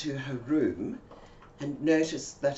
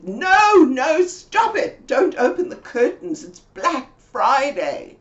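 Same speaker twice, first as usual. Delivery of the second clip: high, loud, slow scream to stop; screaming friend, high and loud; 11 semitones higher, 11 dB louder, slower than usual. Retold or imitated. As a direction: scream at her to leave the curtains. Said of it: "she screamed at me".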